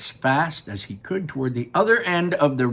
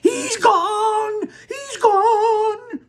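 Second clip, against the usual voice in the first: very high, loud wail; anguished scream; 6 dB louder, much slower than usual. Acted. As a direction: scream at the empty spot. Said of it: "she screamed".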